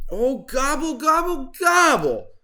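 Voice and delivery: high, loud far-off wailing gobbles; yowling gobble. Acted. Turkey caught surfing, loud yowling gobble. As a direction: yowl like a crushed turkey. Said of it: "yowled Turkey".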